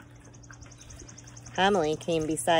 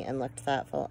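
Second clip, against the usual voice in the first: ordinary delivery, nothing marked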